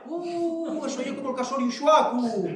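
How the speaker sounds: high, hooting mock disbelief; dismissive village scoff. Villagers scoff at something that will never happen, dismissive hooting. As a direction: hoot it high, without extra volume. Mimics villagers' saying about impossible things.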